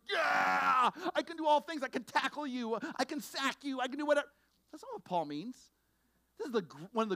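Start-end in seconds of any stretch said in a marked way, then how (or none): 0.1-4.3 high, loud hyped-up football player; mock jock bravado. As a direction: brag like a pumped-up linebacker. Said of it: "be like".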